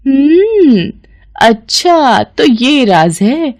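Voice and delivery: slow, drawn-out realisation; knowing hum, then a lingering reveal; sly discovery. Acted. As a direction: stretch it out as the secret dawns. Eavesdropper discovers the secret.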